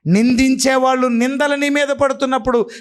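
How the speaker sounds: high, climbing preacher's cry, rising with each phrase; full-force preaching. Acted. Pastor testifying to the congregation, preaching at full force. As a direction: preach it out to the back row.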